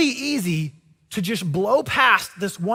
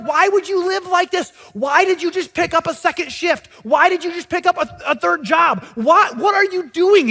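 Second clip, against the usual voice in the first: higher, pressing questions; incredulous badgering; 8 semitones higher, 4 dB louder than usual. People challenging the man over his sacrifices, higher and pressing. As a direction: demand to know why. People give him a hard time.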